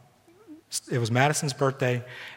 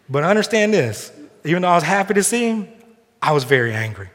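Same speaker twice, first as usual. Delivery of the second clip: emphatic preaching; 5 semitones higher, 6 dB louder than usual. Real, not acted. Preacher stresses point emphatically.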